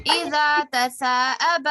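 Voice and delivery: loud recitation. Shown as a demonstration. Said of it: "Repeat after me".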